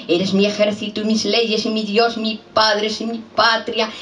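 hurried, incantatory poem refrain; rapid recited litany. Acted. Same refrain repeated in a rush.

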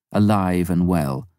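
ordinary delivery

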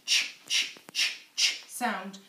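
ch said as a short unvoiced puff, repeated; phonics sound ch. Shown as a demonstration. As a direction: repeat the bare sound ch, ch, ch with no vowel. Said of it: "find the ch".

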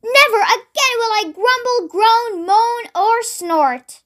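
high, earnest vow, slowing at the end; solemn promise. A narrator's reading. Red-faced pig promising the wizard, solemnly. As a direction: vow it, drawing out the last words. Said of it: "made a solemn promise".

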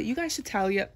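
ordinary talk

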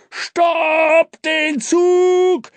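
very long, high desperate yell; drawn-out scream at full voice; loudest scream. A narrator's reading. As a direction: stretch each word into a frantic holler. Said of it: "schrie Dampfi zum letzten und lautesten Mal".

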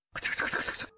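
whispered rattling doorknob noise. Retold or imitated. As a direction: rattle it out under your breath. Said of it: "ドアノブを回してるのです".

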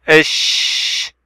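"إش" with a long, softened sh; slow down on the sh of "إش" and hold it; doubled consonant. Shown as a demonstration. Teacher showing shadda like a speed bump, long sh in "إش". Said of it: "نهدئ كالمطبات".

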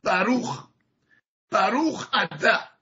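بروخ اتا said slowly with short a sounds, no long آ. Shown as a demonstration.

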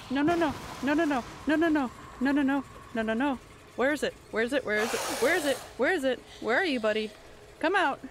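loud repeated no's and calls; frantic shouting. Real, not acted. Gamer frantically searching for the creature, loud panic.

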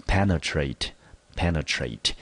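"penetrate" stressed on its first syllable, PEN; 首音节重音. Shown as a demonstration. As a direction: punch the "pe" in "penetrate", lighter after. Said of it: "重音节呢，在第一个音节". ("penetrate"重音放在第一个音节)

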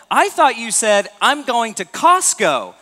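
the clueless husband explaining himself; puzzled husband. Retold or imitated. Husband's confused excuse retold.

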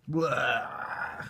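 loud grossed-out blah; disgusted blah. Real, not acted. Reacting to passion fruit.

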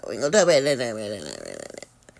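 fade-out mutter; fading away. Shown as a demonstration. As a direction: let it trail off to nothing. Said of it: "repeat fading".

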